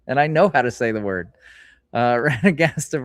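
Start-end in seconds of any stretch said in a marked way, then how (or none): none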